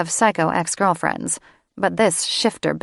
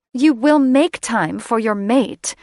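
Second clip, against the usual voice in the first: imperious demand; 5 semitones higher, 5 dB louder than usual. Acted. Catherine demands their attention.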